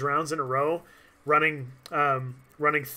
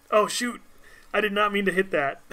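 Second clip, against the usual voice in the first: higher, sudden "oh shoot"; startled exclamation; 5 semitones higher than usual. Real, not acted. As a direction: caught off guard by a mistake. Accidental button press in game.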